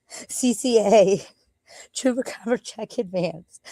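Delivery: slow announcer-style brand name; mock slogan. Shown as a demonstration. Proudly announcing their old business name, slow. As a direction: savor the name slowly like an ad tag. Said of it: "we were gonna call it".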